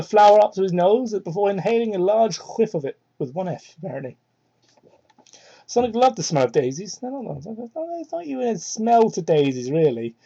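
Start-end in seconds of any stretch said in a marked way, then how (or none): none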